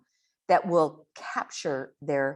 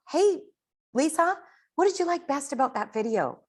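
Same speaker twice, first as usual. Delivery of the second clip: higher, cheerful call to Lisa; bright friendly demo; 8 semitones higher than usual. Shown as a demonstration. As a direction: greet her with upbeat interest. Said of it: "you want to say".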